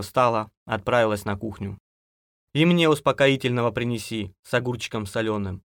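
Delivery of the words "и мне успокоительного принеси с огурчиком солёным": ordinary delivery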